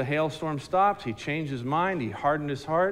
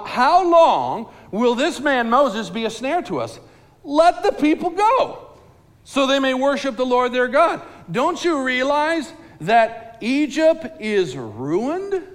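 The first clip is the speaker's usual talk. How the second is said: higher, louder urgent plea, ending slow; pleading officials; 9 semitones higher, 7 dB louder, slower than usual. Acted. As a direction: officials begging Pharaoh to relent, urgent and loud. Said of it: "they tell him this, they say this".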